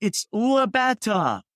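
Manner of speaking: high, slow imitation of a little girl; daughter's voice, imitated. Dad retelling his daughter's invented word, high and drawn out. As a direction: play a child revealing her made-up word. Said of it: "And she said".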